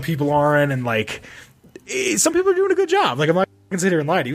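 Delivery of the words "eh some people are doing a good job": high, quick, grudging admission; grudging concession. Real, not acted. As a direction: toss it off high and fast as a reluctant compliment.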